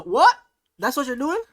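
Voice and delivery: high loud shocked shout. Real, not acted. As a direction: blurt it out in disbelief. Shocked at drugs answer.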